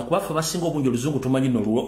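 ordinary talk, nothing marked

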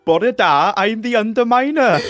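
hammed-up Welsh accent; put-on Welsh accent, laughing slightly; strong Welsh accent. Shown as a demonstration. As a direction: lilt it in your broadest Welsh. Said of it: "strongest Welsh accent".

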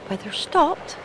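high, rising disbelief; surprised. Real, not acted. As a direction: repeat it in disbelief. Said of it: "the weather stopped?".

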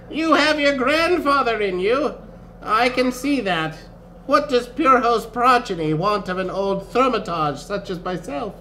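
high-pitched kindly elder; old wizard. A narrator's reading. Old wizard questions his friend's grandchild, high-voiced. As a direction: speak as a wizened old thaumaturge.

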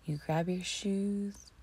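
ordinary talk

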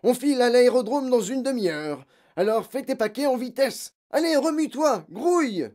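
loud, high-pitched hurrying commands; harry the child to get moving; impatient father yelling; shouted orders. A narrator's reading. Father hurries daughter with orders.

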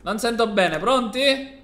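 high playful call; mock deaf call. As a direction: pretend you can't hear them. Host prompting louder reply.

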